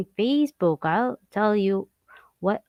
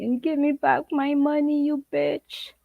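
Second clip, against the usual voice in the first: mock crying victim; 7 semitones higher than usual. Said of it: "somebody's crying".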